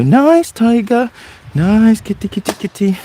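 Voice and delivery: high, slow coaxing sing-song; fawning. Acted. Person trying to befriend a tiger, high fawning coo. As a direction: placate it in a high, drawn-out pet-calling voice. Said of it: "So fawning for a tiger".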